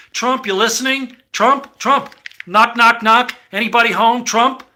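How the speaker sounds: loud mock call with knocking; calling out loudly. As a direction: shout for someone who won't answer. Calling out to the President.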